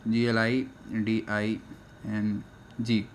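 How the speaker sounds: slow letter by letter spelling of ग्लाइडिंग. Shown as a demonstration. Teacher spells the word for students, slow and deliberate. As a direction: spell ग्लाइडिंग slowly, one letter at a time. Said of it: "ग्लाइडिंग".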